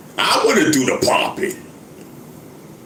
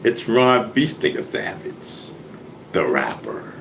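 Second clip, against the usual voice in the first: ordinary talk, nothing marked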